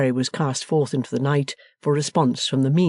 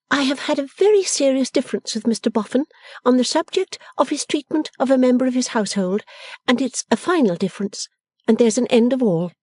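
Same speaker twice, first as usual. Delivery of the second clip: higher-pitched, decided voice; resolute Bella; 11 semitones higher than usual. A narrator's reading. Bella announcing her difference with Mister Boffin, decided. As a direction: declare it finished, pitched high. Bella's figure, declaring a break.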